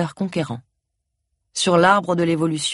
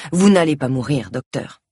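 usual speaking voice

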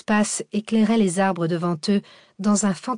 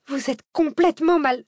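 high-pitched angry protest; outraged outburst; 9 semitones higher, 4 dB louder than usual. A narrator's reading. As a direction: lash out at the driver, pitch jumping up. Cécile enragée frappe le siège. (protestation indignée, voix aiguë)

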